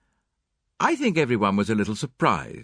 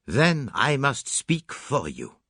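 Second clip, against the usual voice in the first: slow resolve; 4 semitones higher, slower than usual. Acted. Poirot announces resolve.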